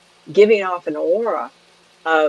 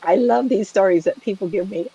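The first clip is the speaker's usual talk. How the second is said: ordinary talk, nothing marked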